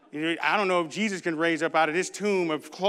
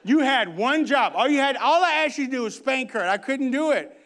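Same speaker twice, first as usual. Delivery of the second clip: loud, high-pitched telling-off; hammed-up loud exasperation; exasperated scolding; 7 semitones higher, 6 dB louder than usual. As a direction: scold like a fed-up wife. Wife's scolding, retold on stage.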